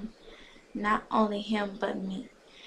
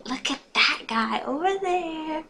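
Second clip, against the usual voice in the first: high-pitched, louder excitement; gushing; 7 semitones higher, 5 dB louder than usual. A narrator's reading. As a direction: point out a cute boy eagerly. Friend gushes over a boy.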